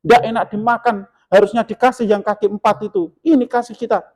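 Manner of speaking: mock scolding. Grumpy guest scolding the women who cooked, mock angry. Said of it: "dimarah-marahin".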